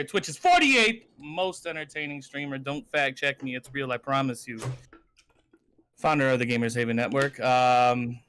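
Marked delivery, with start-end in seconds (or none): none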